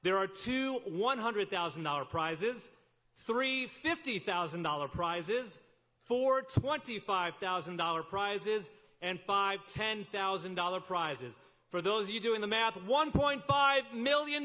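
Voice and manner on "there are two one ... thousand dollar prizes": slow, deliberate prize list; prize announcer. Acted. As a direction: announce each prize tier slowly. Announcing prize tiers slowly.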